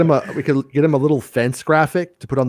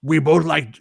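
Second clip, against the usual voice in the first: a hint of a dopey cartoon teen, pitched up; Beavis; 4 semitones higher than usual. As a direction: lightly imitate a friend's cartoon voice. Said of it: "who talked like Beavis forever".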